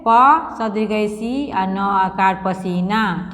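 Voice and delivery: slow, letter by letter spelling of पसीना; teacher spelling पसीना aloud for the class. Shown as a demonstration. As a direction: spell पसीना slowly, each letter clear. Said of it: "फिर इसको पढ़ेंगे".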